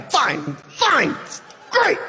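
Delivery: strained denial, last word squeaked high; forced cheerfulness. Acted. Asked if really okay, forced cheer. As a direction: insist you are fine, then leap up high.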